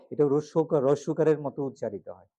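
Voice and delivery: normal talking voice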